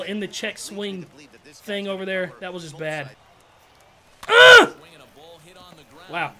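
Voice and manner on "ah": sudden high, loud cry of frustration; pained gamer outburst; frustrated yell. Real, not acted. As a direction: let out a sharp yelp. Frustrated at a bad swing.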